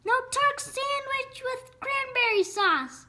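high-pitched exclamation; Runt's high relieved cry. A narrator's reading. Runt glad Turk won't become a sandwich, high cry. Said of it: "cried Runt".

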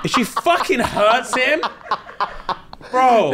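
high, slow, emphatic warning; worked up on his behalf; protective outburst. Real, not acted. Protective threat on contestant's behalf.

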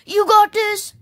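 excited cheer. Pretend. Owner cheering D J before the match, high loud cheer. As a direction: pump up your teammate.

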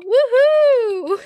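high, loud cheer; excited whoop. Real, not acted. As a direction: whoop it up for the show. Cheering for a show.

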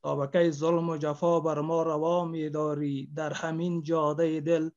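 loud, slow declamation of a sad love poem; grieving poet reciting to the audience; poem recitation. Acted. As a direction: declaim each line like a lament to the beloved.

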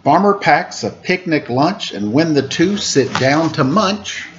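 sing-song rhyming picture-book read; reading to children. A narrator's reading. Host reads a farm picture book to kids, lilting. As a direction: lilt the rhymes for little listeners.

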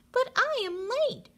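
put-on high, pleading kid voice; a child protesting he's late; little boy voice. Child character protesting in story.

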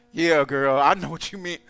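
low, knowing gossip voice, mocked; gossiping churchgoer. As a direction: imitate a two-faced friend joining the gossip. Mocking two-faced church gossip.

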